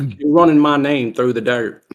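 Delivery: hammed-up Southern indignation; mock Southern accent. Brother protesting his name is slandered, mock Southern accent.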